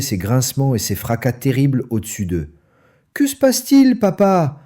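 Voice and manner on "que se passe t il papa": put-on young cubs crying out in alarm; fox cubs crying out. A narrator's reading. Fox cubs cry out to their father, high and scared. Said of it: "s'écrièrent les renardeaux".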